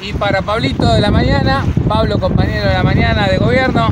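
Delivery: loud over the wind; shouting over wind. Real, not acted. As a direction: call out the greeting over gusts. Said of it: "está terrible el viento".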